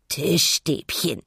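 langsam gegurrte Pinguinstimme; gurren. A narrator's reading. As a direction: gurre es gedehnt und zufrieden. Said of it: "gurrte er". (zufrieden gurrender Pinguin)

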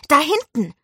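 high, loud cry pointing something out; sudden call. A narrator's reading. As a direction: shout out the spot excitedly. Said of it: "rief er plötzlich". (plötzlich und laut gerufen)

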